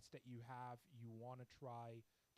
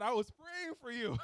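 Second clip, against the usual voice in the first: excited exclaim; 11 semitones higher, 16 dB louder than usual. Retold or imitated. Telling client she prayed.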